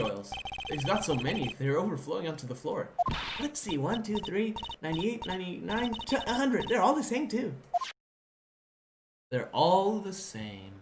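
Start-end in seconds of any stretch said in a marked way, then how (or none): 3.4-7.5 high-pitched character voice; higher voice, discovering as you count; game sidekick counting. Acted. 9.3-10.7 slow, stretched-out delivery; "all" held long in a slow line; drawn-out repeat. Real, not acted.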